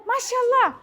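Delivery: ordinary talk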